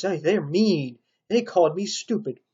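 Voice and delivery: ordinary delivery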